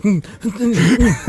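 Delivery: high, tight hums pushed from the throat; strangled throat noises. Retold or imitated. New father who cannot cry, throat noises. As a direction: hum in your throat instead of crying. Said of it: "making weird noises in my throat".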